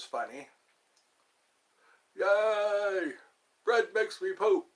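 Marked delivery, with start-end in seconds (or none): 2.2-4.7 high, goofy puppet-character voice; silly, pitched-up comic impression; Special Ed. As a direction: draw out the cheer, then announce it proudly in character. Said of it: "I like Special Ed".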